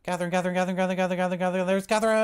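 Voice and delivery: high rapid repeated calling; excited calling. Real, not acted. Excited at friend arriving.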